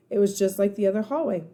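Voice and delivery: plain reading voice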